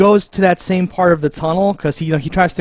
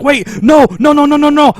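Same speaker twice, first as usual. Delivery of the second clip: rising panicked pleas of no; 9 semitones higher, 4 dB louder than usual. Retold or imitated. Man caught by vines, pleading no in panic. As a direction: beg them to stop, high and desperate. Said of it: "or something like that".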